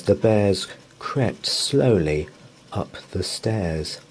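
usual speaking voice

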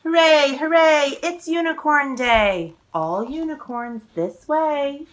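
lively, sing-song picture book reading; reading to children. A narrator's reading. Author reading her book aloud to children, sing-song. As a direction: read the rhymes with bounce for little listeners.

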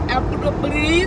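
Applebee's said with a put-on foreign accent, slowed; mock foreign accent. Shown as a demonstration. Vlogger tries the restaurant name in another language, mock foreign accent. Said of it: "I tried to say that in a different language".

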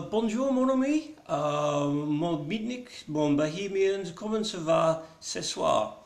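playful French host voice, pitched well up; mock French accent. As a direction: bonjour in a cheesy French accent. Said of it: "And straight back to Cockney".

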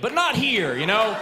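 high, loud, not shouted out; mock shout. Insisting to the New York crowd, a loud mock shout. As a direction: bellow it at the crowd.

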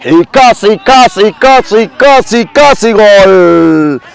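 rising "casi", stretched final "casi gol"; building near-miss call. Real, not acted. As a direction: climb on each casi, then drag it out.

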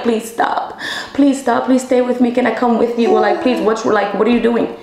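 pleading. Retold or imitated. Begging boyfriend not to leave.

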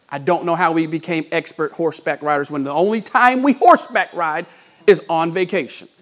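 ordinary talk, nothing marked

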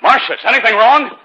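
loud, high, anxious call across the house; calling out in alarm. Acted. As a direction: call down the stairs, worried. Wakes to find Marcia downstairs.

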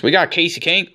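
ordinary delivery

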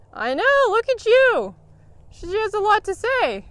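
high, loud, playful cooing to the horse; excited cooing. Real, not acted. Trainer gushing at her chatty horse, high and loud.

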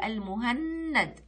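المهند with a long doubled n; shadda demo. Shown as a demonstration. Teacher showing the shadda, doubled n in المهند. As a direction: hold the n in المهند. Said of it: "نلاحظ إنه في شدة".

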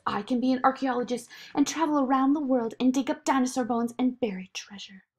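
lightly voiced eager girl, quick at first; Zoe, eager child. Zoe ignoring the voice to dream, lightly eager. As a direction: rattle off a dream with enthusiasm.

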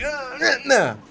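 high put-on grunts of mock pain; wrestler acting hurt. Mimicking staged wrestling, high mock-pained noises. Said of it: "it's supposed to be like".

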